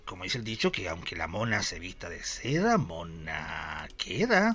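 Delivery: quiet saying. Acted. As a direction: murmur the old proverb drawn out. Cita un dicho. (dicho en voz baja, lenta y grave)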